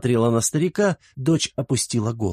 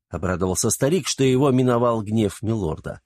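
ordinary delivery

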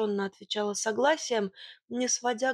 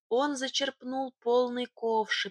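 ordinary delivery

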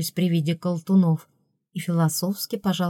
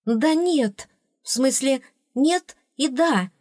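mild flustered cry, higher in pitch; flustered call; 7 semitones higher, slower than usual. A narrator's reading. Princess answering through the door in a fluster, calling out. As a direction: call it out in confusion, pitch raised. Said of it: "крикнула я в суматохе".

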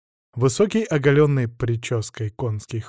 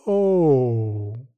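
изумлённое «оу». Acted. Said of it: "Окончательно полезли её глаза на лоб".

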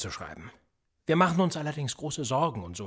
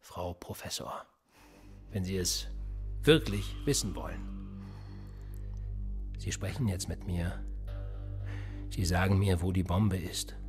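lower, slowing veiled threat; quiet menace; 6 semitones lower, 4 dB quieter than usual. Acted. Interrogator warns professor to tell where bomb is, menacing.

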